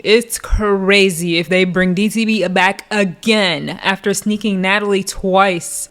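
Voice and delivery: ordinary delivery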